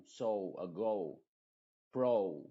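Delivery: so, ago, pro said with English "ou" glide; careful teacher model of each word; English long o. Shown as a demonstration. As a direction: glide the o toward u. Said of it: "No es no ni go, sino go".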